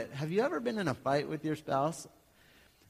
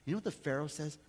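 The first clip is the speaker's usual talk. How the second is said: ordinary talk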